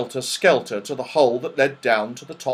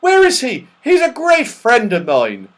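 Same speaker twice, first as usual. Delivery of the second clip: high, loud and eager; crying out; 13 semitones higher, 8 dB louder than usual. Acted. Saucepan man asking after his great friend, excited cry. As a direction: ask eagerly at the top of the voice. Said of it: "he cried".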